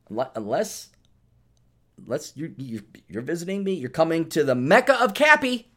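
ordinary delivery

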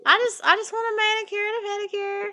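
high, hesitant mock customer voice; flustered customer. Retelling herself stammering her order at the nail salon. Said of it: "And I'm like".

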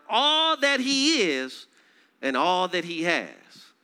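high, loud, slowed scripture proclamation; fervent preacher reading the text; preached scripture reading. Acted. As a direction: proclaim the verse to the room.

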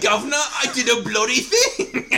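put-on Twenties Cockney accent gangster, laughing through it; old-time gangster. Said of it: "Is that your gangster impression?".

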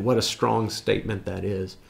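usual speaking voice

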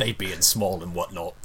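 comic Cockney aside; fake Cockney accent. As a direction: tack it on in a put-on Cockney voice. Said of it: "Fake Cockney Accents".

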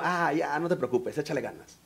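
low, soft brush-off, trailing away; dismissive advice. Retold or imitated. Friend brushing off someone with depression, dismissive. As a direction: imitate someone casually waving away another's pain.

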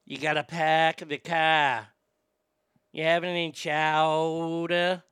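slow, stretched Boston accent; broad Boston vowels, drawn out. Shown as a demonstration. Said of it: "And the Boston one".

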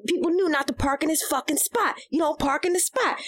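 put-on high, worked-up rant of an old Philly counter man; imitating the counter guy.

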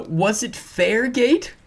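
high, slow, whiny mockery. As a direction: whine it like a sore loser. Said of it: "in a whiny voice".

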